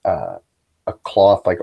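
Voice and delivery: ordinary talk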